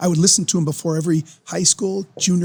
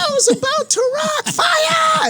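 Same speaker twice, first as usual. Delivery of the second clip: high screeching rock shout; rock singer shout; slower than usual. Retold or imitated. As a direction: belt it like a rock frontman. Imitating [name]'s singing shout.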